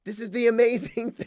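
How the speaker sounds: ordinary talk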